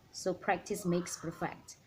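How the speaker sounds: ordinary delivery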